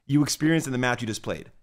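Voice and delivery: usual speaking voice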